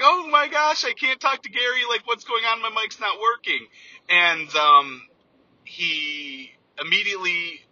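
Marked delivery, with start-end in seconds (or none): 0.0-3.7 retold panic. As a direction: replay the moment the mic failed, voice shooting up in alarm. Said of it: "I was about to cry".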